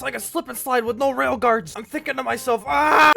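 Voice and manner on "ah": loud, high comic yell; sustained "ah" at full volume; mock scream. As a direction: scream it out as a retold inner panic. His inner scream in the story.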